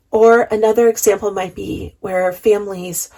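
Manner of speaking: usual speaking voice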